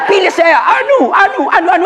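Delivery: high-pitched mock campaign plea; mock nameless candidate. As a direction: imitate a candidate pleading for votes, pitched high. (menirukan caleg tanpa nama dengan suara tinggi)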